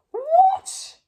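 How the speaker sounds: high, loud shocked cry; king shocked by the green tree; astonished cry. A narrator's reading. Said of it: "he cried".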